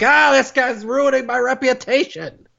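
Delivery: high, hammed-up wail of protest; mock outraged complaint. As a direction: imitate a star aghast at his impostor. Acting out the real [name]'s dismay.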